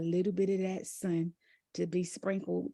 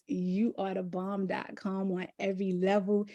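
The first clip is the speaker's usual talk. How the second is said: usual speaking voice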